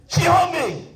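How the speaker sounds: high shouted name call; komandan memanggil. Acted. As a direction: call the next jumper out sharply, high. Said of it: "Panggil dari Medan". (teriakan memanggil, nada tinggi)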